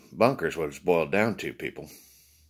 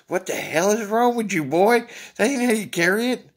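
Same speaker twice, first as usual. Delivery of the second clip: much higher and louder exasperated scold; 12 semitones higher, 6 dB louder than usual. Retold or imitated. Scolding his partner for carrying coffee wrong, loud and high. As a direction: bark at a clumsy rookie.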